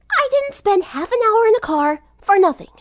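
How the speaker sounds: snapping back after a glare; lightly voiced huffy girl; annoyed tomboy. A narrator's reading. Rainbow glaring.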